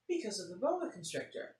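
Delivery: lively storybook read-aloud; animated picture-book reading; reading to children. A narrator's reading. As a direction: tell it like story time for kids.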